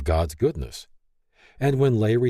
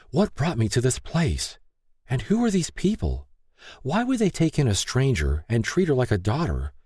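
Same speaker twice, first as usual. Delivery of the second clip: higher voice, pained and questioning; lightly voiced, demand to know why; Lay's hurt letter; 8 semitones higher than usual. Lay's pleading prayer.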